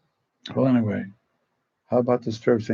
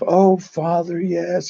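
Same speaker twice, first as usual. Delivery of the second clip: imitated higher-pitched rush of recognition; delighted recognition; 7 semitones higher, slower than usual. Actor recalling an old acquaintance for a priest, pleasantly surprised. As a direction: voice lifts as the memory lands. Eastwood suddenly remembers the priest.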